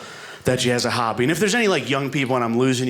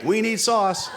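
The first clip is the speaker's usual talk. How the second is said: mock couple; 8 semitones higher, slower than usual. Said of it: "we were like".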